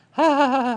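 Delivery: high, loud mocking ha-ha laugh of the old preacher; imitating his laugh. Pastor imitating Brother Norval's laugh, high loud ha-ha. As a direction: bark out his ha ha ha, pitched high. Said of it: "It's kinda how he laughs".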